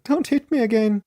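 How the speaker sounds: high-pitched acted plea; lightly acted tearful begging; pleading. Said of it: "pleading and sobbing".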